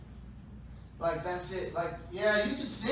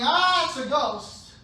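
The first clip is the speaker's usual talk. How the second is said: louder, higher, drawn-out cry of alarm; mock fright; 6 semitones higher, 8 dB louder, slower than usual. Retelling what the disciples were saying, mock scared cry. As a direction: imitate a startled yell, slowly. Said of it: "They thought Jesus was a ghost".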